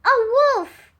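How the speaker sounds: high, loud alarmed shout. Acted. Sam tricking the village, high loud shout. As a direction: cry out the warning. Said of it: "he shouted".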